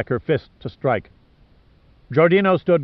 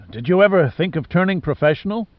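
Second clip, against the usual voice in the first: higher-pitched hoarse character voice; hoarse rasp; 6 semitones higher than usual. A narrator's reading. Giordino quipping after being choked, hoarse rasp. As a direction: rasp it out as if half-throttled. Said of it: "Giordino rasped hoarsely".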